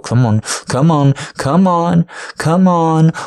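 urging loudly. Acted. Partner urging him to finish, loud high urging.

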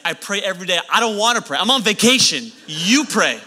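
loud, rising mock-indignant complaint; hammed-up exasperated refusal; exasperated protest. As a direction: throw the request back at them, fed up. Acts out his exasperated refusal.

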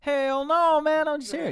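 high, loud mock defence; retold protest. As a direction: yelp of innocence at the cop. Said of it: "And I was like".

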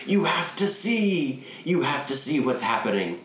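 hammed-up madman insisting they look; mock frantic yell through a cracked door; crazed rant. Crazed man pounding on door.

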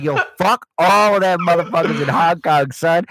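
loud, high hammy voice; hammed-up sellout. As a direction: ham it up as a bought-off shill. Sold-out podcast persona, hammed.

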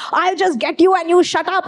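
girl snapping back at roadside Romeos, mock angry; retold angry yell. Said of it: "I got so mad".

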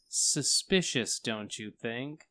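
slow, sly insinuation; insinuating. Pretend. Player hinting the parents are fake, slow and sly. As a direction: drag it out suspiciously.

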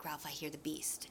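hushed imitation of a scared boy waking his friend; very soft, breath-only warning; frightened night whisper. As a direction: whisper it urgently in the dark. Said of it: "they hear a sound, and Piggy wakes Ralph up".

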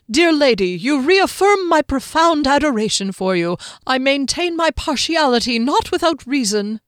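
high, loud, flowery courtly voice; halfling bowing to a lady; gallant halfling. A narrator's reading. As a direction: woo her with grand politeness. Skimnoddle courts Kier.

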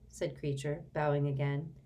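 usual speaking voice

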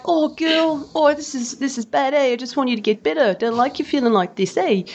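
high, mock New Zealand accent, tender; Kiwi accent. Wife imitating Kiwi husband's comfort, high New Zealand accent. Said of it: "taking the mickey out of each other's accents".

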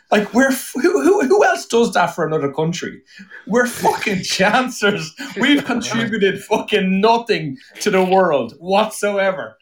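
high-pitched, worked-up self-mocking tirade; comic indignant rant. Real, not acted. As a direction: rant in playful outrage, pitch held high. Comic rant about his own people.

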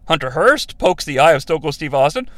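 ordinary talk, nothing marked

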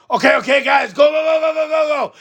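high, rapid-fire rallying of a crew; mock urgent orders. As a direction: pretend to send the guys rushing in. Mock orders to waiting work crew.

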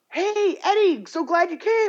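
very high, gushing hello; mock friendly greeting. Classmates greeting the wrong twin, high and overjoyed. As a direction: imitate delighted classmates. Classmates mistake him for Eddie.

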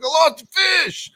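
retold exasperated shout; frustrated shout. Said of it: "I'm like".

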